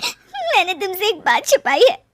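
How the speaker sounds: high tearful voice, close to sobbing; crying. Acted. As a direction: choke the confession out through tears. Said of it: "वो रोने लगती है". (रोते हुए, भर्राई आवाज़ में)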